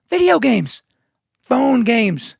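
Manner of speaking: high-pitched disbelieving repeat; incredulous. Real, not acted. Podcaster appalled at grown men on phone games, incredulous. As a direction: say it like you can't believe it.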